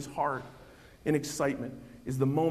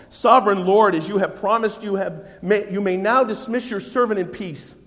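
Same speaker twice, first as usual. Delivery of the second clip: proclaiming Simeon's song; 4 semitones higher, 9 dB louder than usual. Acted. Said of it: "he sings out this song".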